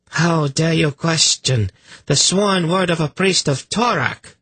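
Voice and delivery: slap down the doubter's insolence; angry priestess. A narrator's reading. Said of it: "Kabat said angrily".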